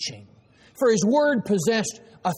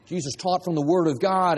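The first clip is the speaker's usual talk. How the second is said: usual speaking voice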